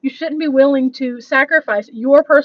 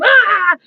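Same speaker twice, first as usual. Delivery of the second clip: frustrated yell; 7 semitones higher, 5 dB louder than usual. Retold or imitated. Retelling frustration with software.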